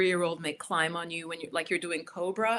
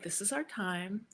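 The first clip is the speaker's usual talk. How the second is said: calm quiet; 6 dB quieter than usual. Shown as a demonstration. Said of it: "And just be like".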